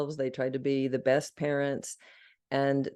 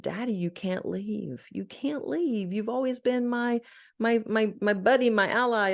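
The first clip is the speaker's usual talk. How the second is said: emotional plea; 7 semitones higher than usual. Retold or imitated. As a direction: beg a dying father not to leave. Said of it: "I used all these emotions coming out".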